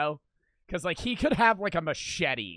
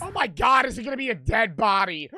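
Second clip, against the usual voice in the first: loud, higher, thrilled alarm; excited shout; 6 semitones higher, 7 dB louder than usual. Real, not acted. Guessing what the suspect hides in his bag, excited shout. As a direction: blurt it out in excited dread.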